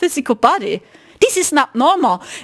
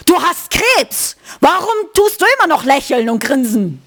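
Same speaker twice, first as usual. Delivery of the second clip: imitated aggression. Retelling the man challenging Marcel's smile, imitated aggression. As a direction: act out a hostile stranger. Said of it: "The energy".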